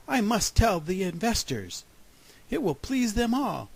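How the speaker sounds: bursting to share good news; pleased excitement. A narrator's reading. Groundhog resolving to tell the investors, pleased excitement. Groundhog delighted by his prediction.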